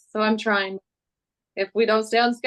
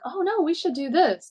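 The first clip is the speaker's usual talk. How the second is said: gentle correction; 7 semitones higher than usual. Retold or imitated. Mother retells correcting child's play.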